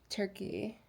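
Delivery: ordinary talk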